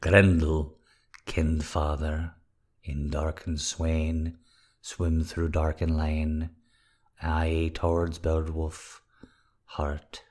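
slow, soft, solemn verse reading; hushed mock-epic reading; dramatic recitation. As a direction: intone it like an ancient epic. Reciting mock Old English verse.

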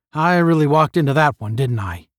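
plain reading voice

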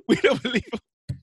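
laughing through the words; laughing hard while telling it. Real, not acted. Laughing while quoting son.

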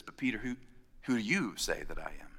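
low and soft, personal; Jesus quietly pressing Peter; quiet searching question. Acted. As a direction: ask Peter gently, face to face.

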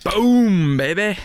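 loud, high "boom" burst; hype shout. Real, not acted. Hype shout over opening beer.

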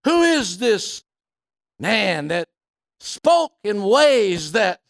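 loud, rising preacher's cry, slow on key phrases; a Sunday-night sermon at full volume; full-force preaching. Acted. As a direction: preach it out to the back row.

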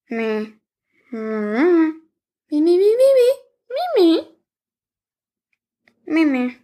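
rising, very high creature squeaks; high squeaky dragon "me" calls; squeaky dragon chatter. Acted. Dragon answers in dragon language.

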